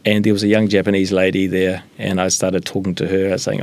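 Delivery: normal talking voice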